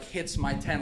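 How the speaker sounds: ordinary talk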